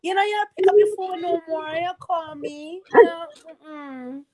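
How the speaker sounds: high, sing-song Trinidadian voice; Trini accent. Retold or imitated. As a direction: lilt high like a Trini friend. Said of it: "the Trini accent because it's so high".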